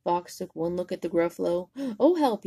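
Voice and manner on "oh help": high, loud yelp; mock panic. Fox seeing the Gruffalo, loud mock panic. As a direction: yelp in pretend fear. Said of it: "he said".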